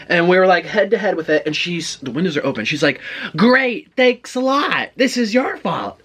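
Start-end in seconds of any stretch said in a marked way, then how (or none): 3.4-6.0 high-pitched, indignant callout; mock sarcastic yell. Friend yelling from open car window, imitated sarcastic outburst. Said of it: "literally, that echoed in the parking garage".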